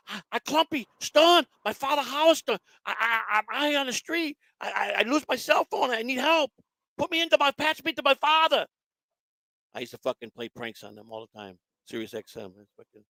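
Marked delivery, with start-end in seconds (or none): 0.1-8.7 high-pitched, stammering plea for help; prank-call character. Retold or imitated. Lost caller begging to reach father, high prank voice. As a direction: put on a high, halting prank voice. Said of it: "prank 'em and tell 'em".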